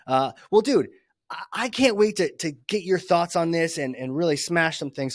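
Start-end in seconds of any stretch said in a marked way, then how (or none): none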